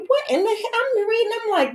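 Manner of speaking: high comic panic over the exam; shocked disbelief. Retold or imitated. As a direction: shriek the question in panic. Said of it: "I was like".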